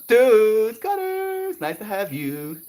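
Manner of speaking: high, loud delighted shout of a name; thrilled high welcome; excited greeting. Real, not acted. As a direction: greet a friend with a squeal.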